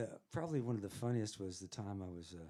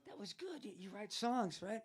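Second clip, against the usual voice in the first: lightly voiced cop, much higher and chirpy; impressed cop; 12 semitones higher than usual. Retelling the cop praising his roadside song, lightly mimicked. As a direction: play the pleased cop after the song.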